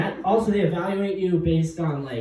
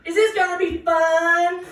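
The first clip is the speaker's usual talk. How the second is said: much higher, slow sing-song voice; mock lady; 6 dB louder than usual. Lady asking the program leader a question. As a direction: ask it like an eager child. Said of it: "She's like".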